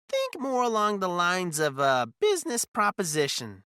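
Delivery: high, loud smirking character voice; smirking schemer. A narrator's reading. Blue-eyed man hinting at a business deal, smirking. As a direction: pitch it up and push the hint through a smirk. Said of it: "a hint of a smirk playing on his lips".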